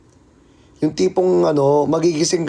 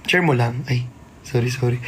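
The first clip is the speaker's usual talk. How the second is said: ordinary talk, nothing marked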